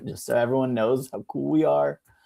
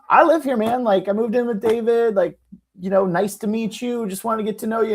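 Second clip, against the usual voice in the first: higher-pitched, placating voice; friendly girlfriend; 8 semitones higher, faster than usual. Retold or imitated. Girlfriend introduces herself to angry neighbor, high friendly plea. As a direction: imitate girlfriend explaining herself.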